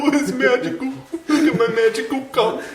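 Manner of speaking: high, goofy celebrity impression; John Travolta. Co-host riffing on a lewd joke, high Travolta impression. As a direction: push the voice up high for a silly impression. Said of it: "more of a John Travolta".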